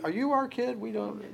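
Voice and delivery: high, hammed-up parents disowning their kid; mock parents. Pastor voicing the parents who deny their own son. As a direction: squeak out the parents' denial.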